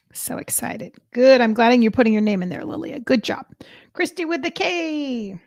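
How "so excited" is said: hushed voiceless excitement; whisper. Real, not acted. Host whispering her excitement to the live chat. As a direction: whisper it, thrilled. Whispered aside during name draw.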